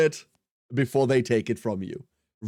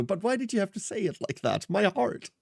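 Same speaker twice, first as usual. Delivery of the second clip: mock hurt; 5 semitones higher, 5 dB quieter than usual.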